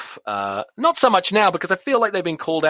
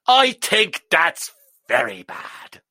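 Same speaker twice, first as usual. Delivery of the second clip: drawn-out, higher-pitched Irish grumble; Irish accent; 5 semitones higher, much slower than usual. Shown as a demonstration. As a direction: put on a slow, scolding Irish accent. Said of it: "in an Irish accent".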